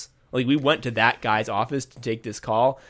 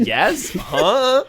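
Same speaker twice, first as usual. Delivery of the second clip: pitched up, baffled yes and huh; confused surprise; 9 semitones higher, 4 dB louder than usual. Retold or imitated. Startled coworker answering a stranger, in baffled surprise. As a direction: thrown off by a stranger asking your name.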